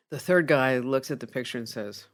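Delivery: ordinary delivery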